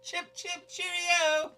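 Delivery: high, mock posh English accent; snarky English accent. Told to say it in a snarky English accent, high mock Brit. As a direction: chirp it like a snooty Englishman. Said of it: "say it in his snarky English accent".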